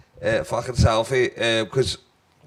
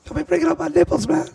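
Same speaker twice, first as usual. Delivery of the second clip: mock offended cat; 14 semitones higher, faster than usual.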